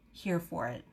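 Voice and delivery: soft, offhand murmur; quiet, unfussed delivery; understated aside. Real, not acted. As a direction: say it low and casually. Said quickly, then repeated emphatically.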